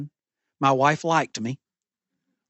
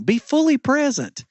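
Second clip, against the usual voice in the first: preaching exhortation; 9 semitones higher than usual. Real, not acted. Climactic exhortation to the congregation.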